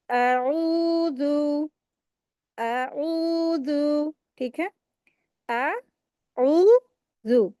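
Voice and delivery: आऊज़ु slowly drawn, ऐन stretched two counts; correct tajweed. Shown as a demonstration. Tajweed teacher models the word for students, slow and drawn. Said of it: "कैसे पढ़ेंगे?".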